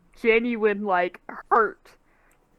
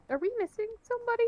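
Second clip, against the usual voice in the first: timid question; 8 semitones higher, 11 dB quieter, much faster than usual. Acted. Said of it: "She'll be like".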